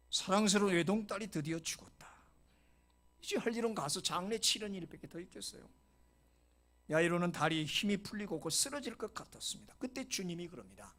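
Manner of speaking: ordinary talk, nothing marked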